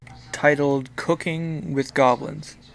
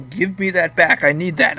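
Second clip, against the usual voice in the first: frantic, loud, grabbing back; crazed voice; 5 semitones higher, 9 dB louder than usual. Acted. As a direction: yell it like a desperate madman. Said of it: "he says in a crazed voice".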